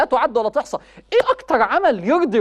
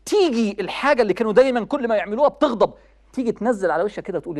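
ordinary talk, nothing marked

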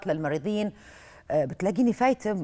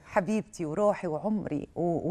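ordinary delivery

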